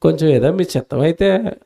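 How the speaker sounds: a preacher softly acting a meek asking of the Lord; humble polite request. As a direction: ask meekly, like a grateful servant. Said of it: "అంటే బాగుంటుంది".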